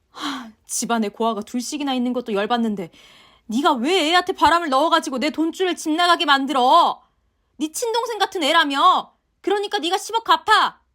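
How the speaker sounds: shrill, loud scolding mother-in-law; angry mother-in-law. Acted. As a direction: berate her daughter-in-law at full volume. Mother-in-law rants about money. (화난 시어머니의 고함)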